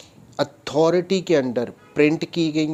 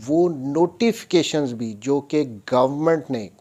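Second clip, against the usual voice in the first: usual speaking voice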